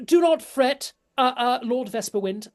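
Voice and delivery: high, loud, reassuring noble lady. Acted. Lady reassures Lord Vesperwind, high and loud. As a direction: soothe the worried lord. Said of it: "she turns to Julius and goes".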